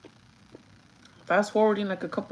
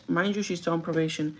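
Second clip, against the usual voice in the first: normal talking voice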